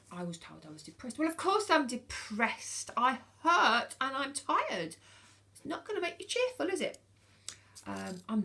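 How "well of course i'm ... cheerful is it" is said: loud, slowed down, indignant; exasperated outburst. Real, not acted. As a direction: throw the words back, fed up. Exasperated outburst about depression diagnosis.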